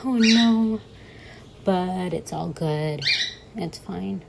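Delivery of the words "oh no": high, loud panicked "Oh, no."; mock scream. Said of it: "I'm not screaming like I was before".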